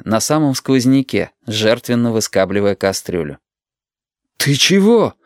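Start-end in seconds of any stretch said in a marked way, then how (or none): 4.4-5.1 high, stunned question; bewildered boy, voice jumping high; dazed bewilderment. A narrator's reading. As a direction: blurt it out dumbfounded, pitched up. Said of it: "очумело спросил Юрик".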